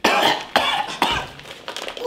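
a put-on sick cough; fake cough. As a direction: cough to fake being ill. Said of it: "Ask me how my sick voice".

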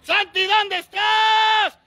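high, loud, slow shout; calling out. Real, not acted. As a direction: stretch the call out to find him. Searching school, calling name. (gritando despacio para encontrar a Santi)

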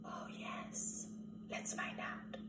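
hushed suspense whisper. Acted.

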